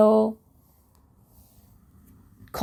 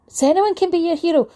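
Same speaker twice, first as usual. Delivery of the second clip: high, quick and louder, full of eager wonder; eager child question; 8 semitones higher, 4 dB louder, faster than usual. Retold or imitated. Retelling schoolkids asking her, eager and high. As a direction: ask it like a thrilled child who just got it.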